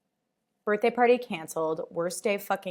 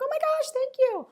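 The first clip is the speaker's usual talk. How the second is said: much higher bubbly voice; bubbly thank you; 4 dB louder than usual. Retold or imitated. Actress thanking a fan, high and bubbly. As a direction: gush like a bubbly star. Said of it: "She was like".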